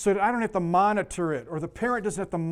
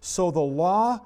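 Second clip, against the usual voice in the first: scripture reading; 5 dB louder, much slower than usual. Acted. Reads scripture aloud.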